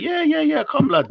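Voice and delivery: a hint of naive locals saying yes to everything; mock gullible Somalis. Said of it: "poor Somalis was like".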